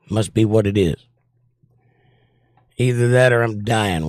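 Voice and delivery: ordinary delivery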